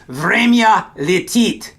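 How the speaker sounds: slow, careful время летит; slow teacher demo of время летит; slow model phrase. Shown as a demonstration. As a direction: say время летит slowly, each syllable clear. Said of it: "the Russian way of saying time flies".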